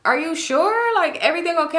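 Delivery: high, sweet mock concern; fake concern. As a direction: sound overly concerned.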